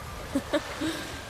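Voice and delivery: a short amused chuckle; light laugh. Real, not acted. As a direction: laugh softly at the memory. Amused by the memory.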